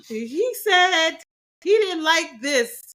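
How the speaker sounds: high, loud insistence; indignant complaint. Retold or imitated. Wife complaining to the therapist, high and indignant.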